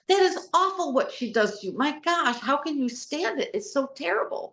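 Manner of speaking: high, gushing fake sympathy; gossipy coworker. Coworker at the water cooler feigning sympathy, high gushing gossip. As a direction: coo it like office gossip taking sides.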